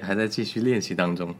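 usual speaking voice

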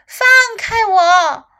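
high, loud, drawn-out shout. A narrator's reading. Little elephant caught by crocodile, shouting to be released. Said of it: "小象大喊道".

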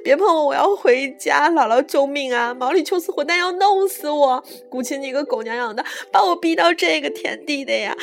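loud, high hysterical tirade; wailing yell. A narrator's reading. Terrified jumper fighting off the coach, wailing and yelling. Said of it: "何木子哇的哭了，喊".

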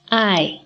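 爱 said as the Chinese word; 示范错误的爱; wrong example. Shown as a demonstration. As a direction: demonstrate the mistaken 爱. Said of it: "这是错误的".

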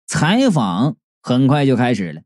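plain reading voice

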